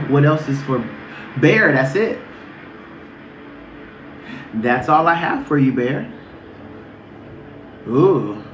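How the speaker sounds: normal talking voice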